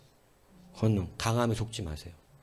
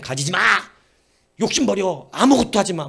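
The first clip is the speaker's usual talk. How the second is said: rising, louder orders of 가지지 마 and 욕심 버려; stern commands; 6 semitones higher, 7 dB louder than usual. Acted. Pastor voices the old preaching as stern shouted commands. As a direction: bark the don'ts like strict rules. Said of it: "막 이런 식이라고, 말씀이".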